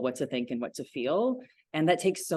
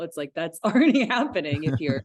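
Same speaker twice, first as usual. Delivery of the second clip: laughing; 3 semitones higher, 6 dB louder than usual. Real, not acted. Laughs while making her point.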